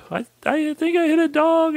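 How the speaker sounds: squeaky, high, mock-upset; high whimpering confession; high tearful little voice. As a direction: play your younger self, small and teary. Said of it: "And I go".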